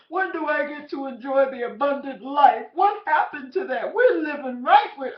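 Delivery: loud, tearful pleading prayer, voice straining high; a woman pouring out her grief to the Lord in the car, crying out loud. Retold or imitated. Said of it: "I was just crying".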